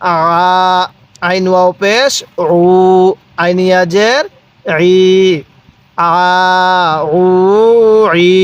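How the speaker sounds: measured spelling chant, holding the vowels in "আ, উ, ই"; drawn-out vowels. Shown as a demonstration. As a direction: stretch each long vowel evenly on a level note, as in "আ". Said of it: "এক আলিফ টানের নিয়ম".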